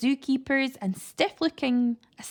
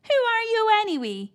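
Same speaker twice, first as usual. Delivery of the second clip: put-on high, loud old lady; high loud grandmother question; high loud granny; 11 semitones higher, 6 dB louder than usual. A narrator's reading. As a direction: demand it of the stranger. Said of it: "she added".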